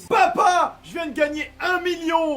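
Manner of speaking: mock jackpot excitement; excited announcement. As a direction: burst in with huge news. Kid won a million.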